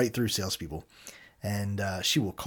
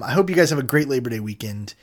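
ordinary talk, nothing marked

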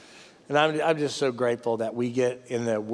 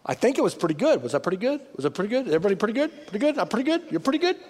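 lightly voiced, hesitant self-doubting questions; mock unsure sinner; 9 semitones higher, much faster than usual. Imagined sinner before God, mildly mocking unsure rising questions. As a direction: play a nervous person seeking approval. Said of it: "we're gonna be standing there going".

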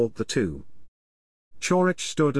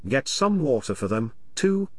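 plain reading voice

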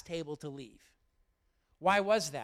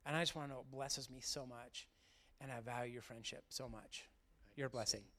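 moved; 4 semitones lower, 9 dB quieter than usual. Real, not acted. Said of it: "I'm about to get emotional".

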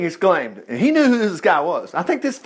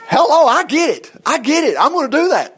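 higher, eager voice of a relieved king; eager Hezekiah; 7 semitones higher than usual. Acted. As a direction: act out the king suddenly getting it. Hezekiah's eager reply acted out.

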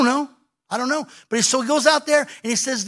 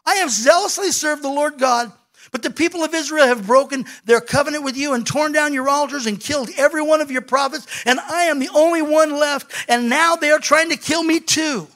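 high, loud outpouring of hurt and frustration; aggrieved, confused complaint, pitched up; frustrated Elijah; 8 semitones higher, 4 dB louder than usual. Acted. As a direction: protest your case to God, voice raised. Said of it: "talks out of his frustration".

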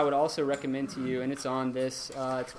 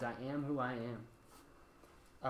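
slow, soft and hushed, each word weighed; solemn voice of God; 10 dB quieter, much slower than usual. Retold or imitated. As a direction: speak as God would declare his name, quietly and slowly. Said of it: "and he says".

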